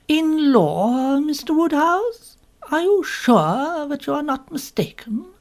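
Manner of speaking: thunderstruck, ask if he is sure; startled spinster asking for confirmation; astonished question. A narrator's reading. Narrator stunned by news.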